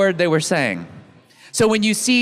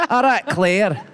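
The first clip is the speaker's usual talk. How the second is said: ordinary talk, nothing marked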